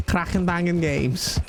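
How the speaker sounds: put-on Scouse studio pitch; Scouse accent. Mock Scouse game studio introduces itself, in a put-on Scouse accent. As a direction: announce it like a proud Scouser. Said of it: "a Scouse accent".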